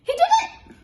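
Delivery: very high, loud parrot shriek. Acted. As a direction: screech the blame. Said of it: "the purple parrot shrieked".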